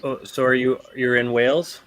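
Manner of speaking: normal talking voice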